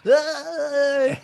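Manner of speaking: high mock squeal of delight; high joyful mock squeal; happy squeal. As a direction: squeal like she's thrilled. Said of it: "and she's like".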